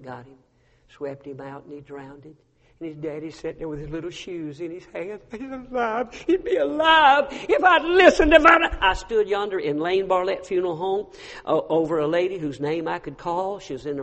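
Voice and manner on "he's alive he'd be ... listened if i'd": grieving cry. Retold or imitated. Father grieving drowned son.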